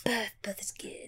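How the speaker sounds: breathy hushed whisper. Real, not acted. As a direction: drop to a whisper. Whispering back.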